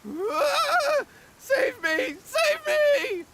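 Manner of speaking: high panicked cries for help; terrified plea. Acted. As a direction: scream for someone to save you. Spirit charges at her.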